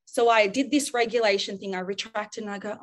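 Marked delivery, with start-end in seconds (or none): none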